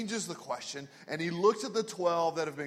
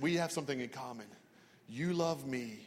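serious; 4 semitones lower, 6 dB quieter than usual. Retold or imitated. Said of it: "looks at her with real serious eyes".

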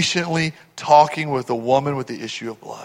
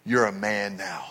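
deep conviction; 7 semitones lower, 8 dB quieter than usual. Retold or imitated. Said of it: "he said with his deep conviction".

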